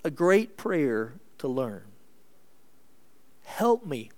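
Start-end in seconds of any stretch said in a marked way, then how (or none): 3.4-4.1 high, brief plea for help; crying out. Acted. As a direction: plead upward without shouting. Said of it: "Just cry out".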